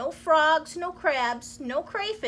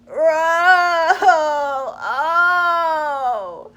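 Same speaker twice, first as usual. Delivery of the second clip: loud, higher pained wail; crying in pain; 5 semitones higher, 10 dB louder than usual. Acted. Crocodile lying on his back, loud crying wail. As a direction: howl and sob with toothache. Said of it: "crying real tears".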